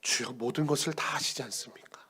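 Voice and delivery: whispered, calm confession. Acted. Peter calmly confesses to Jesus in a whisper. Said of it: "담담히 이렇게 고백합니다".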